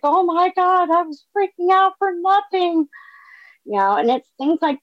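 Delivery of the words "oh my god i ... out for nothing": squealed much higher, relieved and giddy; mock freak-out. To listeners, after sponsor said fine, a high relieved squeal. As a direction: replay your own panicked relief way up high. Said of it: "And I was like".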